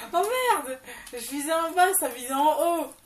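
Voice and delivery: s'exclamer, voix haute de surprise; râler fort après un raté; surprise agacée. Real, not acted. Missed shot in game. (exclamation surprise et agacée)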